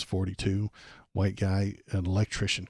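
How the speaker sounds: ordinary delivery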